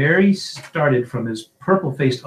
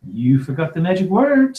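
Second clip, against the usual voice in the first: higher, louder, irritated voice; testy, raised-pitch retort; irritable; 7 semitones higher, 5 dB louder than usual. A narrator's reading. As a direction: say it with mild irritation. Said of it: "ir-, irritably".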